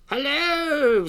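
high drawn-out creature hello; creature's greeting. A narrator's reading. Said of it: "said the creature".

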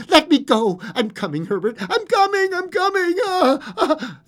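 high, frantic calling; struggling cry. A narrator's reading. Old woman calling to Herbert at the door, struggling cry. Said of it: "she cried, struggling".

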